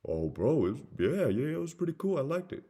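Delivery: lightly voiced easygoing customer; casual customer. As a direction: answer as the called customer, half-committed. Acting the customer replying.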